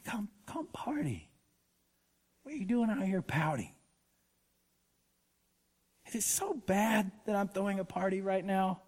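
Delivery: soft, coaxing near-whisper; gentle entreaty. Retold or imitated. Father coaxing his older son to the party, softly. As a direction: coax the sulking son back inside. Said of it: "came out and entreated him".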